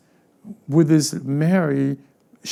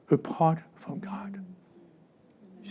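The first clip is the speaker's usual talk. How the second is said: whisper; 2 semitones higher, 10 dB quieter than usual. Real, not acted. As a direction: drop to a hushed whisper. Sermon aside dropped to whisper.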